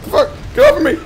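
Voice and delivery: real startled shriek; panicked yelling. Real, not acted. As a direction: yell to fight off an attacker. Grabbed by enemy in game.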